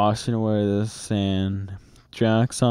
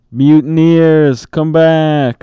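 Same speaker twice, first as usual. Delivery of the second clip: loud, high furious call; shout; 5 semitones higher, 8 dB louder than usual. A narrator's reading. Captain yelling after runaway pirates, loud shout. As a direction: yell after the fleeing crew. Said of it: "shouted Cap'n Bones".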